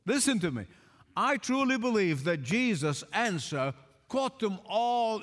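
ordinary delivery

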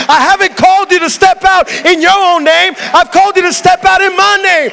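God thundering back at a doubting Moses; God preaching. Acted. God commands Moses to stop looking at himself, thundering preach.

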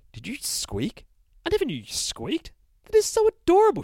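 soaring high, delighted fancy voice; cooing narrator. Acted. Narrator teases the bear about squeaking, high cooing.

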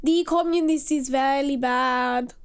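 loud hammed-up German accent; mock German accent. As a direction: keep the silly German accent going. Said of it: "that accent is from Lower Silesia".

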